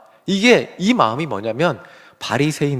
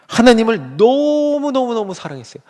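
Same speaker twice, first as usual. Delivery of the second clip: higher and louder, stretching out "너무너무너무"; preacher swept up by Paul's zeal; gushing emphasis; 7 semitones higher, 4 dB louder than usual. Acted. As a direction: pour it out in "너무너무너무" as if the love overflows.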